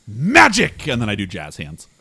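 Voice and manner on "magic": high, loud burst; loud, high-pitched flourish; showy exclamation. Acted. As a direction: announce it like a magician's reveal. Player's character shows off magic.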